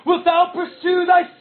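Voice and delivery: high, loud despairing shout; trembling cry. Acted. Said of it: "I trembling cried".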